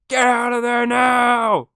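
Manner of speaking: high, drawn-out yell of warning; hammed-up scream, stretched out slowly; mock frantic shout. Podcaster mimics film mother warning swimmer, in a mock shout.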